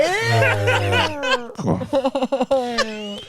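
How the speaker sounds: giggling through a fake seductive line; high laughing put-on lover voice; laughing mock sexy talk.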